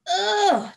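high, loud groan of revulsion; loud girlish noise of disgust; disgusted ugh. A narrator's reading. Girl recoils from awful smell.

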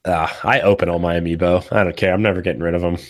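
ordinary talk, nothing marked

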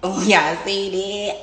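amused slow laughing. Real, not acted. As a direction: laugh while pointing it out. Shows viewers a magic moment.

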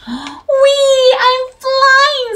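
high, loud delighted squeal. A narrator's reading. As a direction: squeal with glee down the slide. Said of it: "squealed Flippy Flop".

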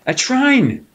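high, sheepish fox blurt; sly fox squeak. Acted. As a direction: admit it with a nervous squeak. Fox blurts over train.

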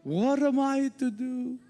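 imitated German accent, high and drawn out; Reinhard Bonnke. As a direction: cry it out slowly in a mock German accent. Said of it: "in his German accent".

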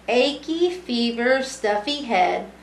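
usual speaking voice

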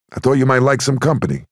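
hearty call up. Acted. Sonny greets Eileen from street.

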